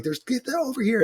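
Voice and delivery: high yell calling someone over; retold screaming. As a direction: shout directions across the crowd.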